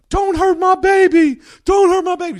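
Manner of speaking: mock scream. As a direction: shriek like a pregnant woman in panic. Pregnant woman screaming.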